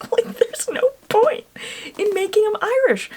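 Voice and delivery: high, exasperated insistence; exasperated rant. Real, not acted. Fan venting about a pointless change, exasperated. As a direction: drag it out high in frustration.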